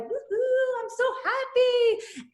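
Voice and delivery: high, slow, gleeful mock cheer; sing-song happy whoop; giddy woohoo. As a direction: play up the joy like a kid. Celebrating the surgery working.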